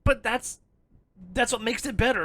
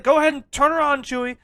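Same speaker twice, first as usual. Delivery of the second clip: louder, higher cocky command; confident; 6 semitones higher, 7 dB louder than usual. Retold or imitated. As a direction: imitate a sure-of-himself pilot giving the order. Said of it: "he said it with such confidence".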